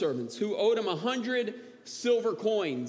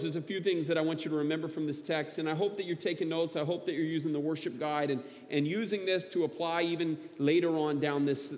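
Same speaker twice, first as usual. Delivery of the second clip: ordinary talk, nothing marked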